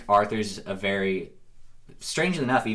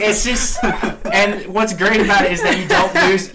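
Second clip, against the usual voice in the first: much higher, louder laughing talk; real laughter; 10 semitones higher, 6 dB louder than usual. Real, not acted. Friend laughing hard at the horse joke. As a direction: crack up mid-sentence.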